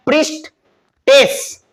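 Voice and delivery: प्रीस्टेस said slowly and sung out; प्रीस्टेस lingered over like a chant; drawn-out teaching word. Shown as a demonstration. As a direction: stretch प्रीस्टेस slowly, syllable by syllable. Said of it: "E double S लग जाएगा".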